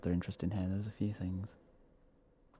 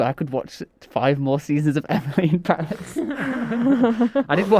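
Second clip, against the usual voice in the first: laughing; 5 semitones higher, slower than usual. Real, not acted. Admitting she watches a bad show.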